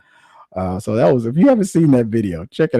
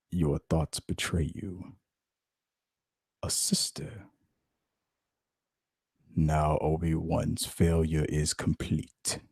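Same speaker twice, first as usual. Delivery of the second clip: very slow, much softer, half-whispered Vader; slow breathy mock Vader, softer; Darth Vader; 4 semitones lower, 6 dB quieter, much slower than usual. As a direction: menace in a hush. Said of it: "even Darth Vader".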